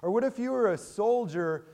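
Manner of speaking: ordinary delivery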